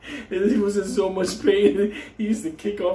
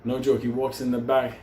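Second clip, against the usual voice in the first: ordinary talk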